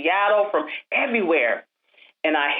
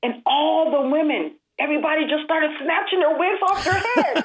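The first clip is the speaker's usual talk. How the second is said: telling how the women bared their heads, laughing; excited laughing; 11 semitones higher than usual. Real, not acted. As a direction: let the joy spill out into a laugh. Excited climax of story.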